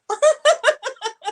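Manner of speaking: bursting out laughing loud; loud high laugh. Real, not acted. Laughs at her performance.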